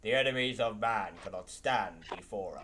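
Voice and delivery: deep gruff warrior line; space marine voice. Acted. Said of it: "I also got a Space Marine voice".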